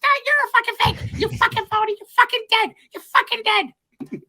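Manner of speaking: very high squeaky cartoon mouse voice, loud and furious; Mickey Mouse. Mickey Mouse threatening a fake Mickey, very high and loud. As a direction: scream it as an enraged cartoon mouse. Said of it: "That's me, Mickey Mouse".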